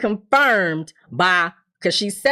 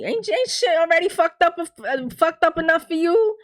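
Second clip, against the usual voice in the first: exasperated rant; 9 semitones higher than usual. Real, not acted.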